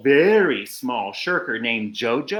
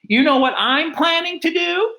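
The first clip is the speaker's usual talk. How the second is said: a hint of a kangaroo, rising higher; 10 semitones higher than usual. A narrator's reading. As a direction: promise it, pitched up. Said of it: "said the big kangaroo".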